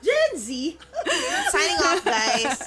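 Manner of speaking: loud, high, laughing exit; mock-announce you're leaving; playful sign-off. Real, not acted. Cohost jokingly signs off from the show, loud and laughing.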